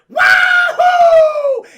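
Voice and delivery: huge high, loud holler; triumphant whoop. Acted. Han swooping in to save Luke, triumphant whoop. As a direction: whoop at full volume like a cowboy.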